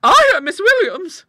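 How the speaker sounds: very high, loud, fluttered reply; palpitating. Acted. Monica introducing herself, still palpitating. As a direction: answer in a frightened high pitch. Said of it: "still palpitating".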